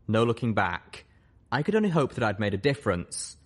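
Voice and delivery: ordinary delivery, nothing marked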